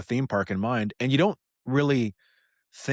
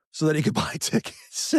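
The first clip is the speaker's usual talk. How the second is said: high, laughing through the words; laughing while talking; 9 semitones higher than usual. Real, not acted. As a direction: tell it through amused laughter. Laughing while telling fan's crazy story.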